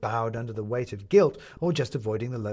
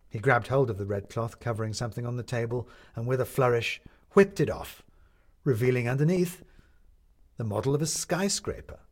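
plain reading voice

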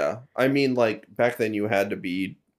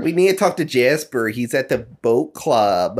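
put-on Midwest accent. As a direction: stretch the last words in a mock Midwest accent. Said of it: "pretend to do that accent".

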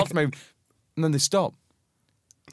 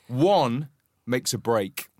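ordinary talk, nothing marked

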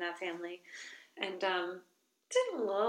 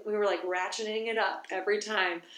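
ordinary delivery

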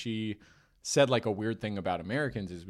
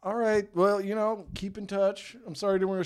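higher mock American country-uncle farewell; put-on awkward American goodbye, pitched up; 9 semitones higher, 3 dB louder than usual. Imitating uncle hugging Russian woman goodbye, higher folksy American voice. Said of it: "he does the American".

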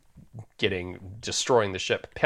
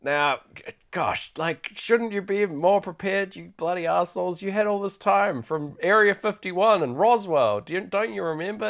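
put-on higher-pitched, scolding old father; mock-indignant father, pitched higher; exasperated old dad; 9 semitones higher than usual. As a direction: nag them like a fed-up dad. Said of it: "David's dad comes in and is like".

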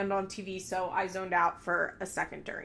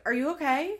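mimicking Kayla; 6 semitones higher than usual. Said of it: "And she's just like".

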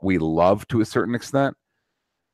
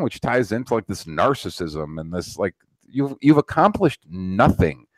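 normal talking voice

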